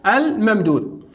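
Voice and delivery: ordinary talk, nothing marked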